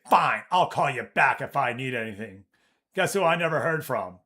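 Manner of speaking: ordinary talk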